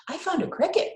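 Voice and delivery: high, eager announcement; excited exclaim. A narrator's reading. Boy showing parents his cricket, excited exclaim. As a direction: burst out with the news. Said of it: "Mario exclaimed".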